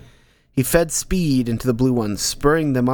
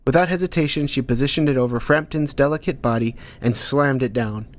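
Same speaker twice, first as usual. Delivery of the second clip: usual speaking voice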